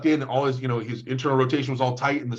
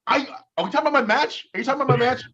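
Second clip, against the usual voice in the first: high, startled and defensive; indignant surprise; 11 semitones higher, faster than usual. Real, not acted. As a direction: protest in alarmed disbelief. Defensive high-pitched protest.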